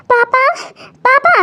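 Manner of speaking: high-pitched call for papa. Acted. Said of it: "क्यों इतनी जोर जोर से क्यों चिल्ला रही हो".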